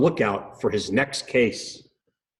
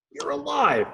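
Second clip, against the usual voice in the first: surprised; 12 semitones higher than usual. Acted. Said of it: "Hubert said".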